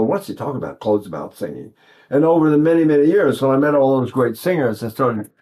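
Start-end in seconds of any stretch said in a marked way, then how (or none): 0.0-1.7 much lower and softer wondering; quiet, low puzzled question; puzzled inner thought. Retold or imitated. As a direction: muse to yourself, low and quiet. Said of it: "I thought".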